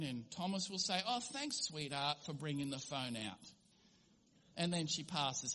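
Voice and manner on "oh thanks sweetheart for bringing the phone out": soft, affectionate husband voice; tender thanks. Retold or imitated. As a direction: thank her gently, lovingly. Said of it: "she'll lovingly hand over the phone".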